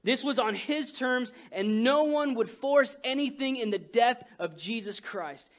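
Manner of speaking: ordinary talk, nothing marked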